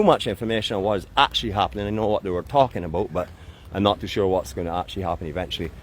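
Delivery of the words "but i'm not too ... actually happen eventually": ordinary talk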